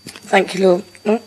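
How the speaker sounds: hoarse, croaky throat. Real, not acted. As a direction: speak through a croaky voice. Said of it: "Sorry about my croaky voice".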